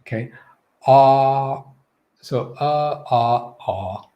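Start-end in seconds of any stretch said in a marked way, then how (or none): 0.9-1.6 Ah held long with the jaw dropped; sustained, firm Ah; fully open vowel. Shown as a demonstration. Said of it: "the fully open position". 2.6-4.1 uh, ah, ah opening step by step; separate, demonstrated vowels uh, ah, ah; three jaw positions. Shown as a demonstration. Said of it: "Those are the three kind of positions".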